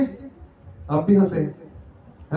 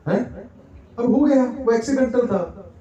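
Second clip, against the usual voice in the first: normal talking voice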